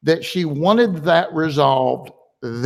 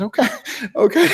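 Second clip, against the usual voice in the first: laughing okay; faster than usual. Real, not acted. Laughing at daughter's panic.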